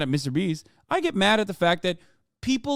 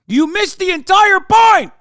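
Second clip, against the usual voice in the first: much higher, much louder yell of frustration; frustrated shout; 13 semitones higher, 10 dB louder than usual. Real, not acted. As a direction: blast it at chat, fed up. Ranting at chat.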